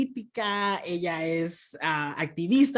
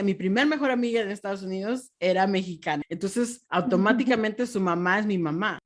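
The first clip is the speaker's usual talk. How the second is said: ordinary talk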